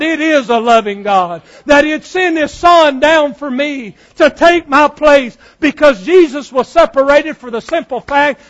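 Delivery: impassioned, driving sermon delivery; fervent revival preacher in full flow. Real, not acted.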